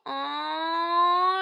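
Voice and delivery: high, long drawn-out coo; adoring aww. Retold or imitated. Fan seeing Damon's face as she woke, adoring aww. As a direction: coo as if melting. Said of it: "I thought that was so adorable".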